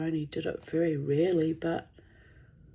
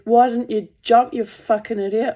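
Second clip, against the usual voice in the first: loud mock scolding; 5 semitones higher, 11 dB louder than usual. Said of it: "She said".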